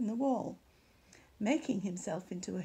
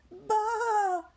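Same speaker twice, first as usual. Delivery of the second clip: much higher, louder all-out sheep call; bleat as loud as possible; 11 semitones higher, 6 dB louder than usual. Acted. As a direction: bleat with all your might. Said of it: "as loud as she possibly could".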